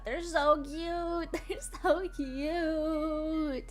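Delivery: very slow, drawn-out adoring coo; long-held fangirl squee; cute gushing. Real, not acted. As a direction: stretch each word out, gushing over something cute. Gushing over the cute models.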